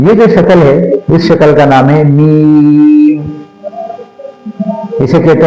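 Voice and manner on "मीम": मीम with a very long, even ee; elongated madd. Shown as a demonstration. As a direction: stretch the ee of मीम steadily. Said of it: "मद होती है इसके ऊपर".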